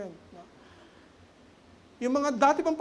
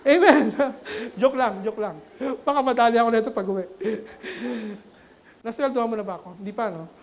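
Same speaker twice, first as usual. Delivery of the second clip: louder, laughing banter; giggling aside about his wife; 6 dB louder than usual. Real, not acted. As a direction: laugh it off as a joke. Laughs after teasing his wife.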